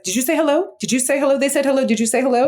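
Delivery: high, insistent "did you say hello"; scolding French parent. Retold or imitated. French parent telling a child off, high and insistent. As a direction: nag the child, pitched high. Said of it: "telling their kids off".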